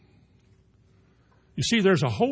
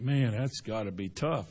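softer, lower, sympathetic sigh of a line; quiet sympathy; 5 semitones lower, 7 dB quieter than usual. Retold or imitated. Believer quietly pitying someone's hardship, soft and low. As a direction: murmur it as if feeling for them. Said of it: "that you think".

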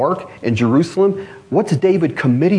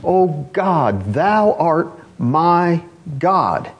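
slow, drawn-out, fervent plea; an earnest cry to God, stretched out; 6 semitones higher, much slower than usual. Retold or imitated. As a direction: plead each word slowly from the soul. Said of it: "He's crying out from the depths of his soul".

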